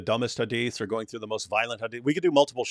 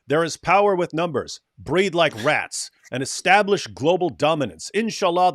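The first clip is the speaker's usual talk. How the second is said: mock preaching; 6 semitones higher, 7 dB louder than usual. Reads extremist tweet aloud, mocking.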